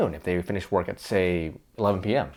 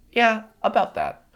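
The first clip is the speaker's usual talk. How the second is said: higher, slow, reluctant drawl; sheepish; 10 semitones higher, slower than usual. Acted. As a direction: hedge it sheepishly. Reluctant reply to energy question.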